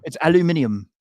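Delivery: "aluminium" said the British way, five syllables; British pronunciation. Shown as a demonstration. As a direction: stress the "-ni-" in "aluminium", British style. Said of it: "aluminum or aluminium".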